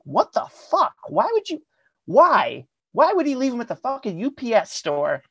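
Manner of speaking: high-pitched, exasperated disbelief; incredulous outrage. Retold or imitated. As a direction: shoot up in pitch, acting out baffled frustration. Retold disbelief at bad news.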